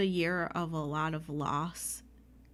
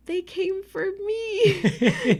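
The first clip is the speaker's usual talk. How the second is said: high, slow, laughing squeal; overwhelmed disbelief; 13 semitones higher, 8 dB louder, much slower than usual. Retold or imitated. Retelling her joy at a full audience, high laughing squeal. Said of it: "And I was like".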